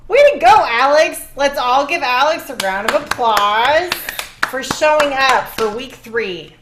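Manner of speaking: loud, high hammed-up cheer; sarcastic hype for the guest; mock cheer. Mock applause for Alex.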